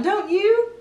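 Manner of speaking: plain reading voice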